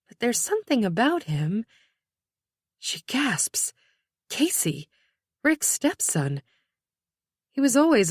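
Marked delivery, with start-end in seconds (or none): 2.8-4.9 whispered. A narrator's reading. Narrator whispers gasp line.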